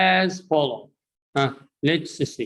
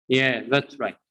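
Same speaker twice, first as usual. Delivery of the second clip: ordinary talk, nothing marked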